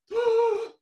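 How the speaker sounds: loud, high intake of breath; exaggerated deep breath in; big demonstrated gasp. Shown as a demonstration. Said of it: "You take a deep breath".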